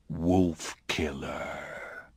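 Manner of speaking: very slow, low whisper; eerie creature whisper. Acted. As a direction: draw it out like a summons from the dark.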